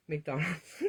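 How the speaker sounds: half-laughing fake cry over McDonald's; mock wail. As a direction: whine it like a mock lament, laughing. Kids want fast food again.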